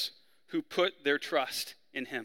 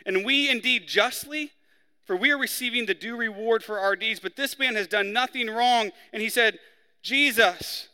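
raised, earnest reading of the crucifixion passage; scripture reading; 5 semitones higher, 3 dB louder than usual. Acted. Preacher reading the crucifixion verses to the church, higher and earnest. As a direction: read it like the story matters to everyone here. Pastor reads Luke aloud.

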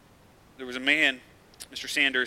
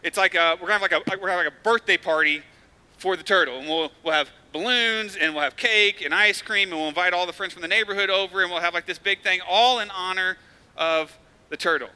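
a louder, higher, eager rush of promises; eager dad; 5 semitones higher, 6 dB louder than usual. Retold or imitated. Dad promising his crying daughter a birthday party for the turtle, eager and louder. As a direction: sell the party to a crying toddler. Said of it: "He's like".

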